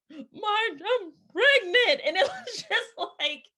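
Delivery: high, slow laughing disbelief; nervous laugh while telling the news. Real, not acted. Recalling calling friend, laughing.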